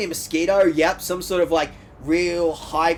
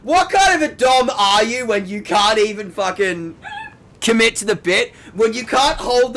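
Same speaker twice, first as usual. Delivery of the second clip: teasing yell; 5 semitones higher, 6 dB louder than usual. Real, not acted. Teasing the friend.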